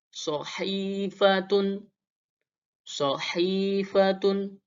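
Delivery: sohifatun slow with a throaty h; Arabic ha. Shown as a demonstration. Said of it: "berharakat kasrah".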